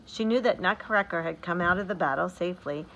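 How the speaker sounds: ordinary delivery, nothing marked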